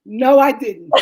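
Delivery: loud, high, laughing denial; husband's denial. Retold or imitated. Husband denying he passed out, loud laughing. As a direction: deny it loudly, cracking up.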